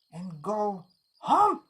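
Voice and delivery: a firm, loud order with HOME drawn out; slow command that jumps up on the last word. Acted. The wolf orders the duck to go home, commanding.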